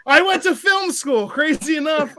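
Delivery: high and loud, mock defensive; mock indignant. Retelling a reply to mom in a high, loud mock-indignant voice. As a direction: play up the film school brag. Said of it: "And I was like".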